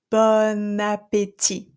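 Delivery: bon appétit slow, N carried into appétit; one smooth word, bon appétit; French liaison; linked N. Shown as a demonstration. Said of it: "So I don't say".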